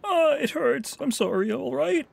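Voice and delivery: high whining mockery; mock whiny crybaby. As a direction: whine it like a crybaby. Mocks whining enemy.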